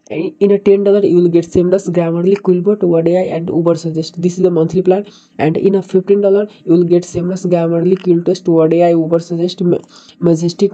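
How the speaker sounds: ordinary delivery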